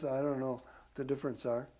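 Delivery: ordinary delivery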